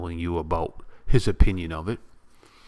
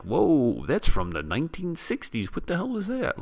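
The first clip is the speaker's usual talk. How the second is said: mock surprise; 8 semitones higher, 3 dB quieter than usual. Imagined reaction to old fashions.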